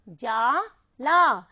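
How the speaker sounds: slow, drawn-out जाला; stretched model word. Shown as a demonstration. Teacher finishing the blend, stretches जाला. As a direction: hold जाला long. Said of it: "हो गया".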